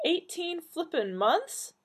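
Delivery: slow, high-pitched incredulous question. A narrator's reading. Donna learning how long she has forgotten, high incredulous question. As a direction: draw it out in rising disbelief. Shocked at lost eighteen months.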